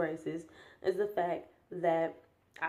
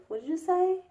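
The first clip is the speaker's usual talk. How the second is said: put-on kid voice; 11 semitones higher than usual. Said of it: "the kids are like".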